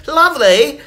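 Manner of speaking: high, loud posh-ish lovely; mock British lovely. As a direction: tease her with her own word. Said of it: "I was like".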